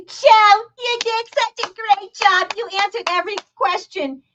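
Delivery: put-on high, gushing talk-to-a-toddler voice; mimicking an over-proud mom; mock gushing praise. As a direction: praise him like a small child, high and sugary. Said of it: "She's acting like he's a four-year-old".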